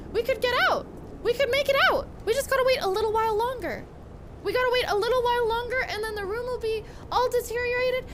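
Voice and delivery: excited. Real, not acted. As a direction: rush with eager hope.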